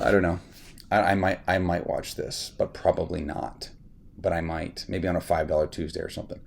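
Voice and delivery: ordinary talk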